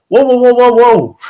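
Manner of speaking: loud high whoa, whoa; hammed-up startled whoa; mock startled. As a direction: pull back loud and high, mock shocked. Retelling reaction to wife.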